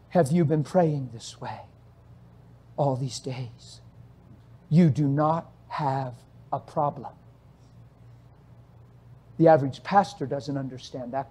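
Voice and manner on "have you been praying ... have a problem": slow, soft and low; calm voice of God. Retold or imitated. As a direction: speak gently, unhurried, like a father. God speaks to the man.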